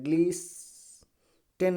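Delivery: ग्लीस्टन with a long held hiss, pause, then "ten"; wrong pronunciation. Shown as a demonstration. Teacher showing students what not to read, t sounded. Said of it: "नहीं पढ़ा जाएगा".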